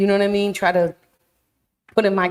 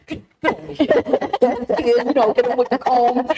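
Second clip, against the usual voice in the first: mock stern mom; 5 semitones higher than usual. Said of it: "if that was one of my kids".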